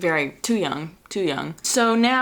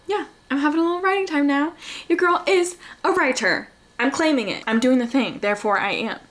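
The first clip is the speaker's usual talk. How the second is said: excited; 8 semitones higher than usual. Real, not acted. Hyping herself up as writer.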